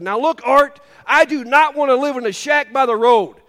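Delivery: a retold lady's loud protest, high and loud; hammed-up complaining church lady; mock indignant lady. Lady telling the pastor uncle off, loud mock protest.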